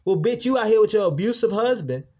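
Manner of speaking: loud and high, fired up; furious clapback. Real, not acted. Hitting back at the coworkers who mocked him, furious. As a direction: blast back at them.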